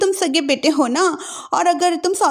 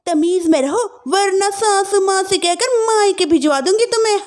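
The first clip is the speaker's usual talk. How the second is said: higher, haughty warning; threatening sister-in-law; 7 semitones higher than usual. Acted. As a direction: scold the new bride.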